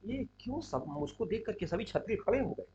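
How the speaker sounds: hushed, puzzled aside; soft murmur of a question to oneself; talking to oneself. Acted. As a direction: wonder it privately, well under your voice. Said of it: "अपने आप से कहते हैं".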